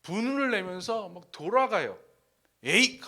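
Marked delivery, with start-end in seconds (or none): 2.6-3.1 loud mock huff of anger; angry. Preacher plays Naaman turning back, in mock anger. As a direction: burst out in acted annoyance. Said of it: "하면서 막 화내면서".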